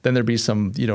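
usual speaking voice

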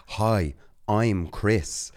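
"Hi, I'm Chris" stretched out very slowly, every word spelled out; very slow and clear. Retold or imitated. Newbie introducing himself very slowly and clearly at the gym. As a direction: draw each word out carefully for a stranger. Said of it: "Like, speaking real clear".

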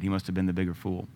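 usual speaking voice

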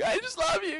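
put-on very high, sweet child's "I just love you"; little child voice. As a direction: squeak it like a small boy.

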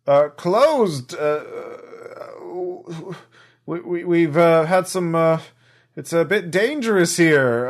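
put-on an honest hardworking elderly drow worker. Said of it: "elderly drow voice, uh, ac-, slightly accented".

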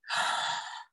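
a quick, soft, breathless gasp. Real, not acted. Moved wife gasps at husband's words: soft gasp. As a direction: catch your breath, moved.

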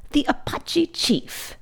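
high, slow, hushed disdain; scornful schoolmaster. A narrator's reading. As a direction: read the title out with drawn-out contempt.

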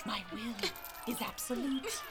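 quiet gloating menace; menacing hush. Acted. Elder threatening captive girl.

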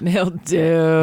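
laid-back surfer drawl on the word; California surfer voice. Shown as a demonstration. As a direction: say it like a stoned surfer dude. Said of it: "in a California surfer voice".

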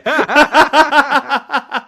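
big high laugh bursting out; loud burst of laughter. Real, not acted. Host cracking up loud at the guest's joke, laughing.

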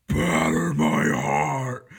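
slow, very loud roared verse line; roaring recitation. Retold or imitated. Professor roaring Donne's poem into the mic, slow and loud. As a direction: roar the line like a sermon at the mic. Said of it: "in the loudest voice I've ever heard".

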